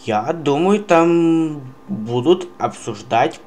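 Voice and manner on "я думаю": ordinary talk, nothing marked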